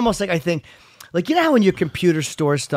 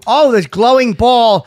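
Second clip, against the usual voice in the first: loud, higher, drawn-out amazement; surprised discovery; 6 semitones higher, 8 dB louder, slower than usual. Real, not acted. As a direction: exclaim it in real surprise. Discovers the balls glow.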